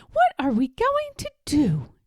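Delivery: high, sing-song well, well; a kindly teacher musing; art teacher voice. A narrator's reading.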